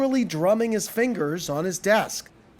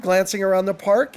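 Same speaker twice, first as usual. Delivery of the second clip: plain reading voice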